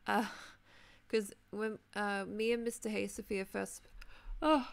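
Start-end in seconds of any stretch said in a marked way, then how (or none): 4.4-4.7 yawning mid-sentence; a real yawn through the word. Real, not acted. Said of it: "I'm yawning for some reason".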